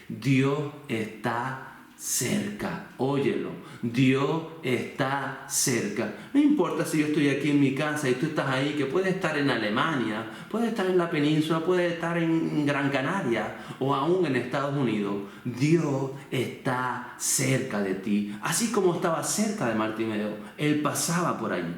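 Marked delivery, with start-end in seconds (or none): 0.1-2.9 very slow, word by word; slow and weighty declaration; slow, deliberate emphasis. Real, not acted. Preacher stressing key message. 3.8-6.1 drawn out, each word set apart; slow, emphatic proclamation; slow, deliberate emphasis. Real, not acted. Preacher repeats key message. 15.4-18.3 very slow, each word set apart; slow, weighty assurance; slow, deliberate emphasis. Real, not acted. Preacher brings message to listener.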